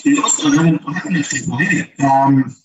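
muffled bad audio, like underwater; garbled connection, sound drowned out. Real, not acted. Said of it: "you sound like you're underwater".

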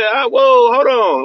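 high, loud startled yelp; startled flinch. Retold or imitated. A startled flinch while telling viewers about massages. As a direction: jump like something touched a sore spot. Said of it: "you say".

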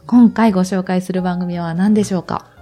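ordinary talk

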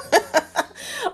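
brief chuckle; light laugh. Real, not acted. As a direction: laugh at your own joke. Laughs after tease.

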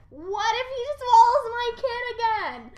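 high anxious mother; worried mother. Retold or imitated. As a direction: fret about the child. Rhea worried voice.

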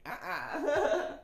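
high, loud scared refusal; hammed-up frightened squeal; mock fear. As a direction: play too scared to move, half laughing. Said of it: "act like I was just afraid".

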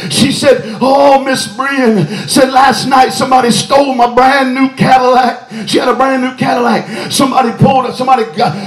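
chanted preaching cadence building to shouted, high-pitched peaks; full-force preaching. Real, not acted. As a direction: preach it in driving bursts, climbing to a shout.

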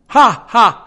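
loud, high squawking ha ha; a shrieking bird's cackle; loud screeching parrot laugh; screamed parrot laugh. Acted. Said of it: "screamed Polly".

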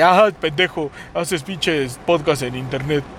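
mock critic quote. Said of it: "un pinche criterio malo".

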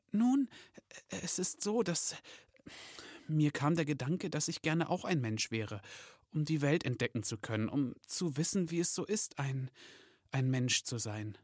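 nervous confession. Acted. Elf confessing his wish to Santa, nervous and hesitant. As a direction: confess timidly, feeling for the words.

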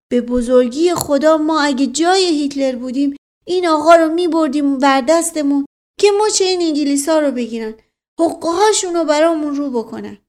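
loud, high, fervent flattery; eager loyal servant. Acted. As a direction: chime in zealously to back the master.

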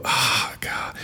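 breathy whisper, fading out; exhausted whispered groan; whispered exasperation. Real, not acted. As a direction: whisper it in disgust.

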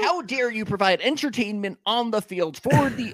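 high, sarcastic, condescending voice; mock the coach lecturing a player like a baby; mock-parental scolding of a player; mock patronising coach. Mocking patronising manager.

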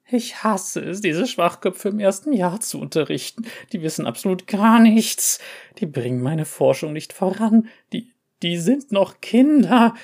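grumpy cat ranting. A narrator's reading. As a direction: rant like a cranky professor.

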